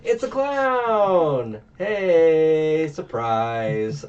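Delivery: high, loud, slow reveal; spring the twist on them; real surprise, loud and high; surprised. Real, not acted. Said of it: "I was surprised by that".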